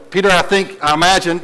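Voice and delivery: normal talking voice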